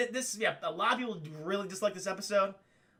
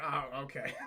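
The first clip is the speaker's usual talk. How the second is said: low, soft, doubtful acceptance; mock placating cop; 8 semitones lower, 6 dB quieter than usual. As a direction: humour her quietly, half amused. Retold cop humouring the woman.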